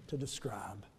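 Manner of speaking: awed whisper. Acted. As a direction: breathe it out, almost silent. Awed preacher drops voice.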